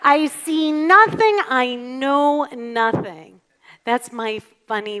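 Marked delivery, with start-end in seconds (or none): 0.0-3.4 loud, slow, high German sergeant; German accent. Acted. Getting the congregation to laugh a little, German accent. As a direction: draw it out in a mock German accent. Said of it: "so that's my German accent".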